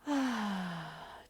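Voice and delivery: deep sigh. Shown as a demonstration. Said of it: "And another deep breath".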